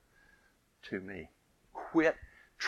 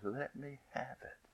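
gentle coaxing; 2 semitones higher, 13 dB quieter, slower than usual. Retold or imitated.

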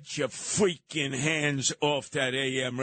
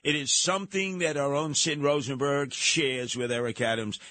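normal talking voice

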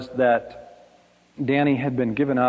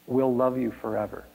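normal talking voice

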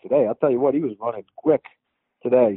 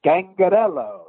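Scangarello announced with relish, higher pitched; relishing the name; 5 semitones higher, slower than usual. Real, not acted. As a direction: savor every syllable of the name. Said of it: "you get to pronounce Scangarello".

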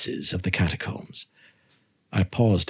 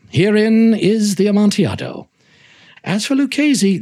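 luring call; 11 semitones higher, 5 dB louder than usual. Acted. Montresor luring him into niche.

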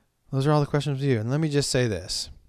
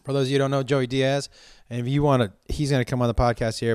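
ordinary delivery

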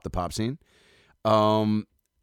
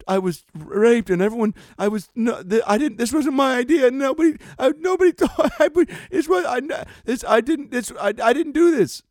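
much higher and louder, panicky hammed-up denial; frantic Holmes impression; 7 dB louder than usual. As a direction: squeal out a mock-hysterical plea of innocence. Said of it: "I'll do my Elizabeth Holmes".

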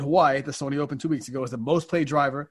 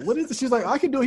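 put-on high puzzled girl voice; young worker puzzled by the clerk, high and unsure; confused girl; 9 semitones higher, faster than usual. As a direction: sound lost but willing.

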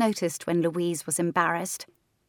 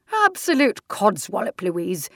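dismissive aunt; 2 semitones higher, 5 dB louder than usual. Acted. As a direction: scoff at the idea.

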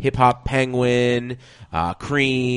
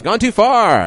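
high, loud comic warning; loud punchline; 11 semitones higher, 9 dB louder, slower than usual. Acted. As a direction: shout the joke ending. Ad directions punchline.

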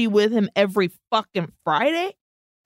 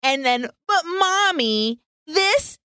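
put-on high, slow whiny little-girl voice; mock her as a clingy child; 5 semitones higher, slower than usual. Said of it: "mocking Alicia as a child".

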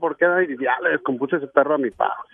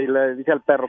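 ordinary talk, nothing marked

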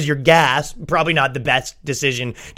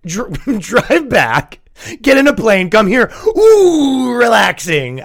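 mock exasperation; 7 semitones higher, 3 dB louder, slower than usual. Mocking mom's plan step by step.

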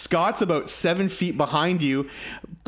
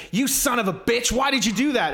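higher-pitched imitation of an angry friend; mock angry accusation; 6 semitones higher than usual. Co-host replaying Melanie's accusation over the purse, mock anger. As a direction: retell her outraged accusation, mildly hammed-up. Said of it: "starts screaming at me".

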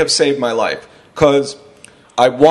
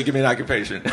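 lower, softer-edged deadpan insistence; mock serious; 3 semitones lower, 6 dB quieter, faster than usual. Comic pretending to press shot man for occupation, mock serious. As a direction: drop the pitch and insist as if in earnest.

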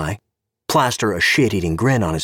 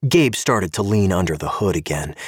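ordinary delivery

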